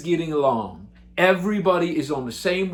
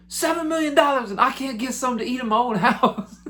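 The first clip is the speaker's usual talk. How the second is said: much higher, indignant falsetto complaint; 9 semitones higher than usual. Retold or imitated. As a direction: whine at the empty fridge. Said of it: "and he goes".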